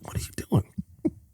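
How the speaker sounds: slow urgent whisper; hushed whisper. Retold or imitated. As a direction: whisper it in disbelief. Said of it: "my sister was like".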